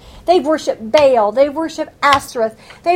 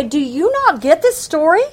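loud, pointed rebuke; confronting the leaders; 5 semitones higher, 4 dB louder than usual. Pretend. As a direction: scold the nation's leaders, hammering each question. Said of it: "read them this and say".

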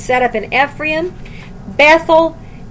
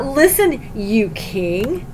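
sarcastic; much slower than usual. Acted. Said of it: "Can you hear the sarcasm there".

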